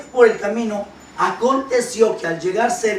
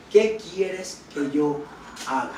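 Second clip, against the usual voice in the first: slow, drawn-out, lightly fearful question; slow down and let it sound a little afraid; slow trembling plea; 5 semitones lower, 6 dB quieter than usual. Retold or imitated. Preacher voicing a frightened Saulo to Jesus, slow and lightly fearful. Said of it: "Él, temblando y temeroso".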